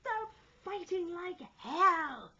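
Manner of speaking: high, loud, slow outcry; gasped shout. A narrator's reading. Stubbins from the cross trees, gasping shout. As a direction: shout it out in gasps. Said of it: "shouted out all at once and in gasps".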